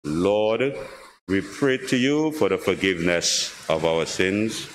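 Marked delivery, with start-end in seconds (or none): none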